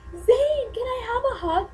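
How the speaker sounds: very high, loud begging squeal; reenacted fangirl squeal. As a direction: call out shrieky to a passing idol. Replays calling out to singer.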